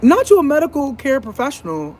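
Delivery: high, disbelieving sass; incredulous retort. Retold or imitated. Patient scoffing at the doctor in a high voice. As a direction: scoff at his expertise. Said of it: "I'm like".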